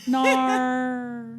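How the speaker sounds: put-on a stretched-out Australian accent. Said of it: "The only thing I can say is".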